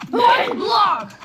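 high, loud yell of disbelief; outraged shout. Real, not acted. Fell through one block.

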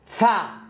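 فا with a long open "a"; open vowel. Shown as a demonstration. Teacher showing F followed by A, open-vowel فا. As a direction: open فا out as "faa". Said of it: "فيصبح الإف هذا مفتوح فتصبح".

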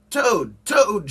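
high, loud call at the door; shouting. A narrator's reading. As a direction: shout out to rouse a friend. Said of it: "shouted Frog".

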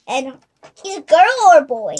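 normal talking voice

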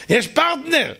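long mock "oh", then high and laughing; gleeful shouter. Angry man delighted his opponent shouts back, gleeful. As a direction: relish finally having someone to fight. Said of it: "אם אתה עונה לו בצעקות".

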